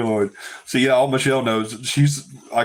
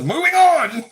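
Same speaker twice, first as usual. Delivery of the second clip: high, loud imitated call; mock order to move on; 6 dB louder, slower than usual. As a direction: call it out, mimicking an impatient producer. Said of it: "John says".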